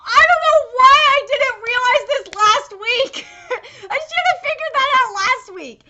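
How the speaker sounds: loud, high, worked-up outburst; excited exclaiming. Real, not acted. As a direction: exclaim it, kicking yourself for missing it. Excited realization of the irony.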